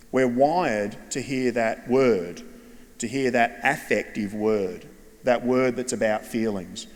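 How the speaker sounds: slow, stress on wired and that; slow emphatic point. Shown as a demonstration. Speaker to audience of teachers, slowed emphasis. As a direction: spell out each word for the room. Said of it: "teaching point, slowed emphasis".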